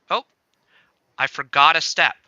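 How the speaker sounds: usual speaking voice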